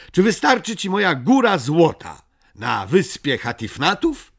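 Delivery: high, loud, eager character voice; Migotek calling out his offer. A narrator's reading. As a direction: pitch it up and project to the other side. Migotek makes Buka an offer. (wysoki, donośny głos Migotka)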